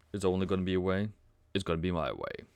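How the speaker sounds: low, understated Bond-style line; James Bond. Retold or imitated. Trader quoting James Bond to viewers, a hint of suave spy. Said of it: "as James Bond".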